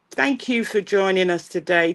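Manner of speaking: ordinary talk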